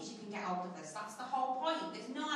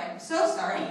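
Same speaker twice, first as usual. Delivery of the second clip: loud, high, giggling sorry; real mock-contrite laugh; laughing apology; 8 semitones higher, 12 dB louder than usual. Real, not acted. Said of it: "but sorry".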